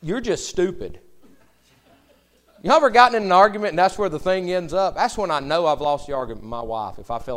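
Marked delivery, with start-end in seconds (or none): 0.0-1.0 loud, high, slow exasperated insult; a mock outburst that has run out of arguments; hammed-up angry retort, loud and high; losing an argument. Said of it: "you end up saying". 2.6-6.4 excited aside. Real, not acted.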